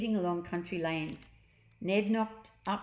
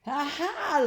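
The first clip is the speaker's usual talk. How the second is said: high, louder mocking ha ha; girl laughing; 8 semitones higher, 5 dB louder than usual. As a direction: laugh at the caged grown-ups. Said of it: "laughed Jemima".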